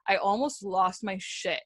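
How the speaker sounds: ordinary talk, nothing marked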